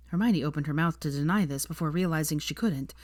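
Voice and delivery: plain reading voice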